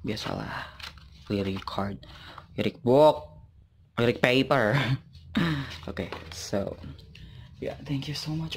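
usual speaking voice

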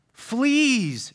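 cried out. A narrator's reading. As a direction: yelp at the bite. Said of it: "she cried".

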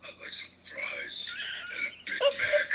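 high croaked fast-food order; Froggy voice. Retold or imitated. Friends ordering McDonald's in the Froggy voice. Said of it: "So we're like".

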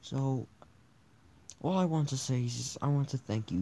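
ordinary talk

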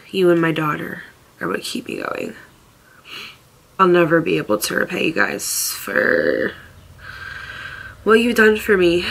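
ordinary talk

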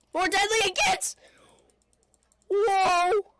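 loud, high outburst; alarmed shout. Real, not acted. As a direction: voice leaps up in alarm. Alarmed by the advancing wave.

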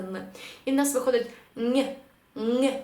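«н» pressed with the back of the tongue to the palate, nasal; Spanish ñ sound. Shown as a demonstration. Teacher demonstrates the nasal Spanish ñ for students. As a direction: hum «н» through the nose like a blocked nose. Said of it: "намагатися сказати".

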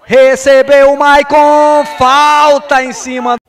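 loud, stretched call; excited call. Real, not acted. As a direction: bellow the chance. Sudden chance, loud call.

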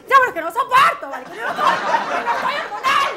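very high, loud protest with a laugh; shrill denial. Acted. Woman insists she is not hormonal, very high and loud. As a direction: insist loudly, close to hysterical.

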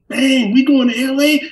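can't believe the trip is real; excited. Retold or imitated. Nineteen-year-old hearing about the LA trip, high thrilled outburst.

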